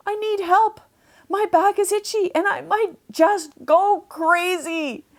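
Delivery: high, loud desperate call for help. A narrator's reading. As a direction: manatee calls to the photographer for help, high and loud. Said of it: "called out".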